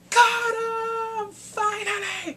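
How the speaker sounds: high, slow, stretched-out cheer; excited shout. Real, not acted. Viewer cheering as the villain is caught, excited shout. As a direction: cheer like the long wait is finally over.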